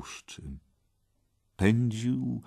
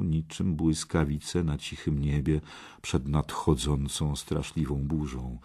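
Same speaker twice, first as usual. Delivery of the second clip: ordinary delivery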